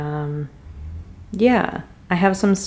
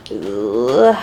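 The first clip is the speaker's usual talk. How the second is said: frustrated ugh; 7 semitones lower, 7 dB louder than usual. Real, not acted. Frustrated at painting.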